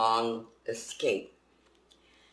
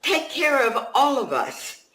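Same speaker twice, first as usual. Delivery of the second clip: much higher, louder, slow question; wondering refugees; 12 semitones higher, 8 dB louder than usual. Acted. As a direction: lift it high and loud in disbelief. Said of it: "they wondered".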